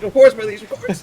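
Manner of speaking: high-pitched, hurried grovelling; cowering. Acted. Baron cowers before his angry liege, high and servile. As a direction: shrink before an angry master. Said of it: "He's, he's cowering. He's like".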